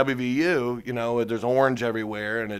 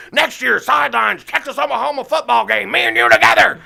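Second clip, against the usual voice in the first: much higher, louder gravelly-free manic imitation; 12 semitones higher, 11 dB louder than usual. Telling [name] his name, loud high manic imitation. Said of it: "And he's like".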